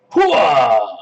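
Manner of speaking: loud military hooah shout; loud gung-ho soldier. Pretend. Cannon fires, triumphant cry.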